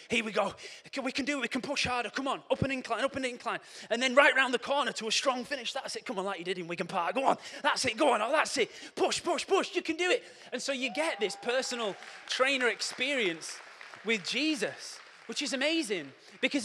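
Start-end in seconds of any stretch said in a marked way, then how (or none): 0.1-10.2 high, loud cheering on; excited encouragement. Acted. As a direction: hype them up up the hill. Acted coach urging a climb.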